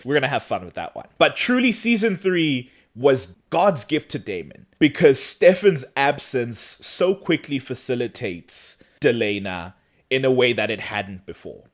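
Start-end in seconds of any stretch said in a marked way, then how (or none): none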